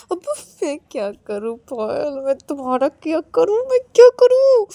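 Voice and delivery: high, anguished plea rising to a loud wail; acted despair. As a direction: build to a helpless cry at the end. Acted despair at partner in quarrel. (बेबसी में चीखती आवाज़)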